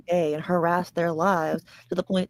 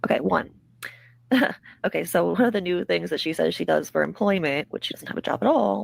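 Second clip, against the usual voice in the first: normal talking voice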